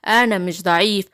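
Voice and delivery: low-pitched wolf retort; low, indignant storybook wolf; offended wicked wolf. Acted. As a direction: deny it as the big bad wolf, pitched low. Wolf answers Simba's taunt.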